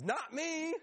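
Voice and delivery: high, rising mock protest; piping up. Peter pipes up to deny betraying Jesus, high-pitched. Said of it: "who pipes up and says".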